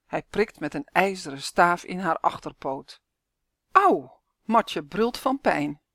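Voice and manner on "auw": sharp loud high cry of pain; yelp of pain. Acted. Lion girl jabbed by keeper, yelping. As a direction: yelp as the bar jabs. Lion pricked with iron bar.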